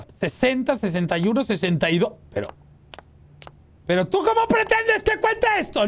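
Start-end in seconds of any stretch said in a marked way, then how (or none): 3.9-5.9 high, indignant complaint; exasperated protest. Real, not acted. Gamer told to count too many pumpkins, high exasperated protest. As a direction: protest an impossible task in a high voice.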